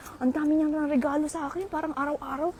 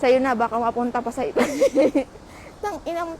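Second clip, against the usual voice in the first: normal talking voice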